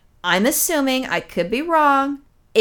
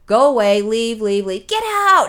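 retold yelling; 4 dB louder, slower than usual. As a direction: chase her out, getting louder. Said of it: "He starts yelling at her".

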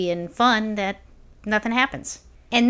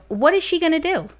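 high, scoffing inner voice; pitched-up scornful thought; mocking inner voice; 6 semitones higher, 3 dB louder than usual. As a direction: sneer at her offer in your head, pitched up. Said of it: "I'm thinking to myself".

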